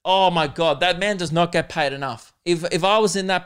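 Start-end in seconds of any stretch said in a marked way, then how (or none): none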